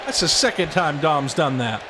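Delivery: ordinary talk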